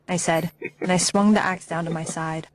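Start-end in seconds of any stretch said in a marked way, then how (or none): none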